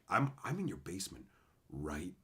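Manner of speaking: low, soft, halting menace; mock creepy. Caller saying crazy stuff to people, mock creepy. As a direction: drop low and quiet, spacing out the last words. Creepy prank line on phone.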